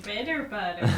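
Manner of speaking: mock whimper. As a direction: whine it like a pretend cry. Mock lament over bitter bread.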